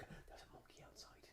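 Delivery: hushed, voiceless warning to a friend; acted-out frightened whisper; panicky whisper. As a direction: whisper it like a paranoid secret. Confiding panic to his friend in story.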